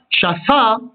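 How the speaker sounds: shafa with the weight on fa; accent the final syllable of shafa; shaFA, ending stressed; stress on last syllable. Shown as a demonstration. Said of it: "accentué sur la dernière syllabe".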